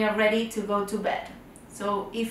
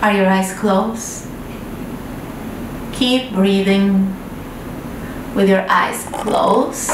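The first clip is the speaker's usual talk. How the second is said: unhurried breathing cues, louder than the chatty intro; guided meditation; 3 dB louder than usual. Acted. Guide to children lying in bed, guided meditation voice. Leads breathing before bedtime story.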